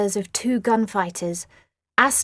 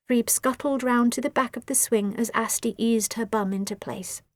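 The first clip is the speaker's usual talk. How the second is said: plain reading voice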